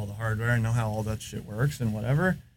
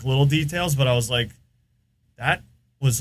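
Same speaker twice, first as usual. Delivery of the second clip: ordinary talk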